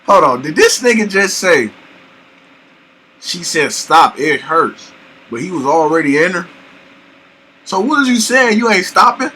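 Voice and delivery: loud, high-pitched disbelief; shocked and loud, demanding an answer; talking too loud. Real, not acted. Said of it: "I know I talk too loud".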